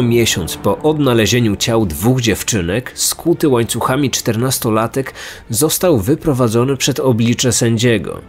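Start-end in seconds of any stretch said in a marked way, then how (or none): none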